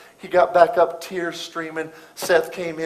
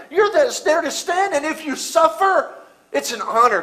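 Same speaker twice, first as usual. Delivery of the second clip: loud, high sermon delivery; preacher's climax; full-force preaching; 9 semitones higher, 8 dB louder than usual. Acted. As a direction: preach it to the rafters.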